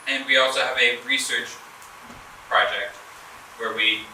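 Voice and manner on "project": usual speaking voice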